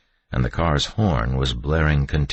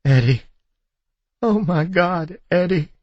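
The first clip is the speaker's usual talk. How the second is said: high, overwrought cry; distraught, high-pitched wail of relief; sobbing; 5 dB louder than usual. A narrator's reading. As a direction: cry out through convulsive sobs. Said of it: "her body racking with convulsive sobs".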